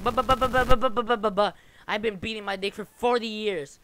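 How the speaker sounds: high, loud sputtering "but, but, but" of a flustered viewer; mocking stammer. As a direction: sputter the buts like a caught-out whiner. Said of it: "But you be like".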